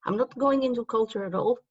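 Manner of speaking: usual speaking voice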